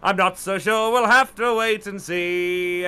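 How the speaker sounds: grand theatrical English voice, rolling the place names. Acted. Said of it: "your [name] impression".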